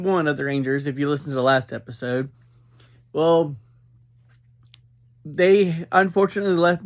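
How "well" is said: usual speaking voice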